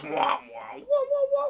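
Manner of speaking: mouthed bass wobbles jumping high; imitated dubstep wobble. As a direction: go wah-wah like a dubstep drop.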